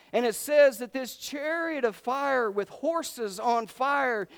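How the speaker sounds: high, driving sermon narration; fervent preaching. Acted. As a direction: preach the spectacle with stretched, punched words. Preaches at full force.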